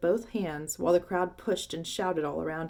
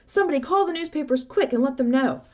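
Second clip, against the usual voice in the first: excited yelling; 8 semitones higher, 7 dB louder than usual. Acted. Shopkeeper still yelling.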